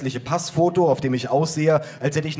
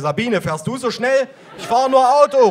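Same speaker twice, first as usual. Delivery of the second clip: calling voices; 11 semitones higher, 6 dB louder than usual. Acted. Cops call to each other.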